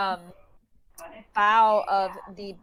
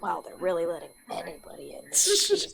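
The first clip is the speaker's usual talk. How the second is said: whisper; 6 dB quieter than usual. Acted. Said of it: "you can hear him whisper".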